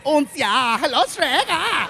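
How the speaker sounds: high German-accented cartoon piggy; squeaky mock German pig voice; Three Little Pigs. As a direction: put on a silly storybook pig accent. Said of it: "like the three little pigs from the movie Shrek".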